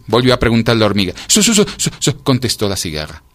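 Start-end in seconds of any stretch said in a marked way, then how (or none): 1.3-2.2 high, rapid chattering syllables; stuck on one syllable from the cold; freezing creature's high stammer; shivering stutter. A narrator's reading. Freezing cicada stammers again.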